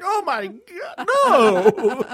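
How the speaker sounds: high, drawn-out mock horror breaking into a loud no; horrified denial. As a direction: wail it in comic disbelief. Friend's horror, retold.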